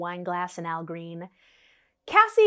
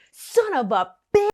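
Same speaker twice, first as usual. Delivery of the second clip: higher, louder burst of fury; mock-furious curse at the cheater; angry curse; 6 semitones higher, 5 dB louder than usual. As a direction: spit it out, suddenly furious. [name] realizes he cheats.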